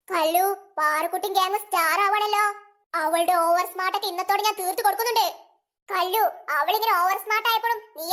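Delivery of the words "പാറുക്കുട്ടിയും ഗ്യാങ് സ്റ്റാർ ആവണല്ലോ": normal talking voice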